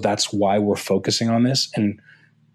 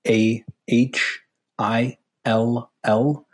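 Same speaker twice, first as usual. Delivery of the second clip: very slow, letter by letter; spelling out; much slower than usual. Real, not acted. Guest spells his handle for listeners, spelling out slowly. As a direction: leave space after each letter. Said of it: "I'll actually spell that out".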